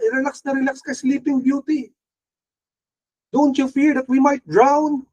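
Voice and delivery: mock panicked disciples. Disciples on the sinking boat waking Jesus, mock panic. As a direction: play the scared fishermen begging a sleeping Jesus. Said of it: "Sabi nila".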